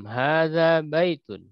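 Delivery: slow, falling answer; slow answer model. Shown as a demonstration. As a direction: answer calmly and slowly, letting it fall.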